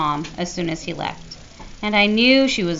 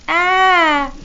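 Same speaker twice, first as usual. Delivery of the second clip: put-on high, loud little-boy "Ah!"; a playful imitation of a scare yelp; mock scream; 11 semitones higher, 7 dB louder than usual. Jamie downplaying his scream to Mom, mock yelp. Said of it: "It was just like".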